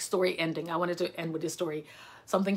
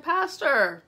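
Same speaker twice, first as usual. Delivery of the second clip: high, somewhat loud second call; calling out again; 8 semitones higher, 5 dB louder than usual. Retold or imitated. The speaker calling the pastor a second time, raised. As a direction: call him once more, a bit less hard.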